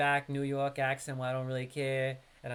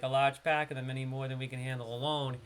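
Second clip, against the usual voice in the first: same quest line in a relaxed, louder New York accent; 3 dB louder than usual. Shown as a demonstration. Streamer rereads the quest to chat in his natural New York accent. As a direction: let the New York accent roll off the tongue. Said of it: "if you listen to me read with my New York accent".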